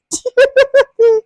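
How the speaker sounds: high, loud real laugh; burst of laughter. Real, not acted. Speaker cracking up at her own stakeholder joke, loud high laugh.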